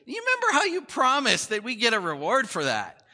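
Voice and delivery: loud, high imitation of an eager kid; kid angling for a reward. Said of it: "My kids are like".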